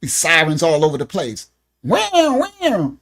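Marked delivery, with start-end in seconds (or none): 1.8-2.9 a higher, light mock siren wail; siren noise. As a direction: play at being a siren alarm. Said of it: "I need a siren, a siren button".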